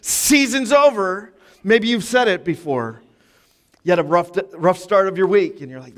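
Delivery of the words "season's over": mock despair. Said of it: "I'm like".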